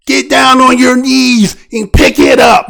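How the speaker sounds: mock cop shouting a command; police-style command. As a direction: imitate a police officer barking orders. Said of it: "She talking like a police".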